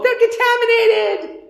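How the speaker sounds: high singsong chant; kids' taunt. Retold or imitated. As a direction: sing it out like a kid teasing. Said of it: "we would go".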